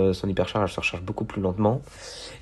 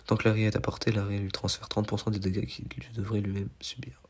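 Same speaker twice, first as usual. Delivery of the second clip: soft whispered reading; much softer unvoiced read-out; reading under breath; 6 dB quieter than usual. Real, not acted. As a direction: whisper the gadget text to yourself. Reading the gadget text under breath.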